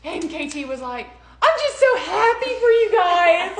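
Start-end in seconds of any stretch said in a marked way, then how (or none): 1.4-3.6 high, loud, gushing joy; a friend congratulating the couple, gushing and loud. Retold or imitated. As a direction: burst out with happiness for them.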